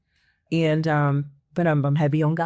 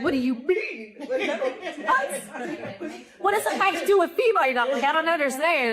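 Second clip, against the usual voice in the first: much higher, fast, laughing disbelief; baffled disbelief; 9 semitones higher than usual. Retold or imitated. Retelling her reply to the pastor's words, baffled high laughing disbelief. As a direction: squeak out confused questions, half laughing.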